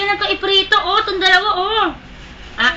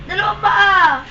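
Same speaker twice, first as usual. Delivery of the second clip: ordinary talk, nothing marked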